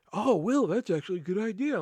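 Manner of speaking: lilting mock approval; mock pleased coworker. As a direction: lightly mimic a surprised, approving coworker. Coworkers' pleased reply, acted out.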